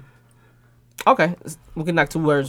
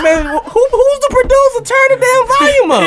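mock complaint; 4 dB louder than usual. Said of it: "I'm like".

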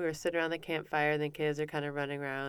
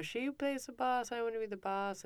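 higher, softer mock child's complaint; complaining child; 8 semitones higher, 5 dB quieter than usual. Upset daughter telling mom her side, high little-kid complaint. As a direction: imitate a small girl airing her grievance. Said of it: "And she told me".

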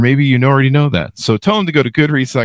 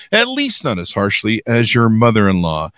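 usual speaking voice

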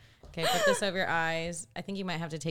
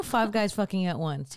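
normal talking voice

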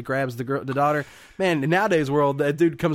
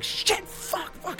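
panicked whispered swearing, pitched high; whispered swearing amid a car pile-up; whispered cursing; 6 dB quieter, much slower than usual. Retold or imitated. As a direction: breathe the curses out in a whisper. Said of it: "he's like".

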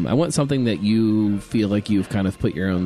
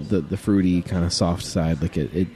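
ordinary talk

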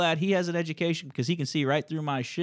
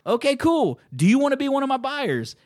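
mock cheerful; 11 semitones higher, 6 dB louder than usual. Heated phone argument, mock cheerful.

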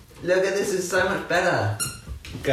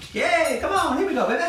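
high coaxing; 13 semitones higher, faster than usual. Real, not acted.

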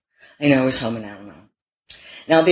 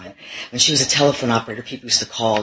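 usual speaking voice

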